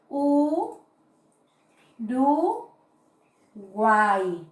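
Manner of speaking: U, ru, guay split into three beats, guay as one syllable. Shown as a demonstration. Teacher splitting Uruguay into syllables for the class. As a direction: sound out Uruguay slowly, syllable by syllable. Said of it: "de la siguiente manera".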